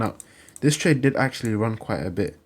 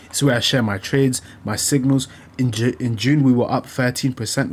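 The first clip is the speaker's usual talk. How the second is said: ad read; 5 dB louder than usual. Acted. Reads his own VIP ad.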